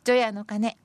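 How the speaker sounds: projected, deliberate verse reading; senryu recitation. Acted. Presenter reading New Year's Eve senryu, deliberate recitation. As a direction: announce the poem phrase by phrase.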